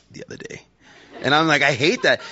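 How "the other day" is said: whispered, embarrassed admission; sheepish whisper. Retold or imitated. Admitting to buddies he cried, sheepish whisper. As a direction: barely let it out.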